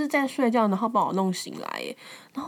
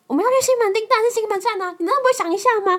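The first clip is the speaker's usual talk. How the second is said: much higher, loud and fast scolding voice; condescending boyfriend; 12 semitones higher, 6 dB louder, faster than usual. Retold or imitated. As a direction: talk down to her, fast and loud. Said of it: "他说". (高声又快地训斥)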